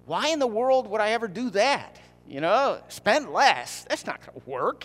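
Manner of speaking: high, loud protest at a silly idea; mock incredulous. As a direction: balk at it in hammed-up disbelief.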